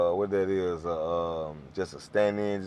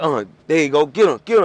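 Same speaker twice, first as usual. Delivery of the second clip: loud, raised mock hollering; retold chase yell; 5 semitones higher, 8 dB louder than usual. Boys chasing the ball carrier, loud urgent yelling. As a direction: sic the pack on the runner.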